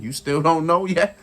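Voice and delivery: normal talking voice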